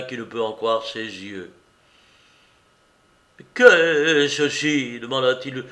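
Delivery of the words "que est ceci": dazed horror. A narrator's reading. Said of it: "d'un air horrifié".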